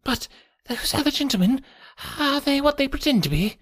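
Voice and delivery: high, wary quavering question; reedy, quivering voice. A narrator's reading. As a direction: ask suspiciously in a thin, high voice. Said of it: "came a reedy, quivering voice".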